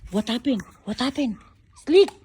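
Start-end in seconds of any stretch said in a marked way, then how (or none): 1.8-2.1 loud high call to the dog; sharp scolding call across the yard. Real, not acted. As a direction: shout the dog's name to stop him.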